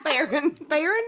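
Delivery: high, loud Southern "barren"; Southern accent. Shown as a demonstration. Teased co-host shows how she says barren, laughing Southern accent. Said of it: "How does April say barren?".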